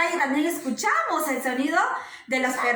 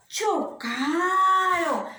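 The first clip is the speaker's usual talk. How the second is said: alargado; much slower than usual. Acted. Explica el choque de carros.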